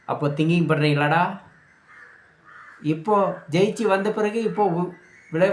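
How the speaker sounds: ordinary talk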